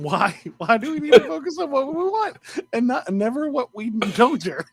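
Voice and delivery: giggly, high and tangled; cracking up mid-sentence; laughing. Real, not acted. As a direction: laugh through the question. Laughing at own garble.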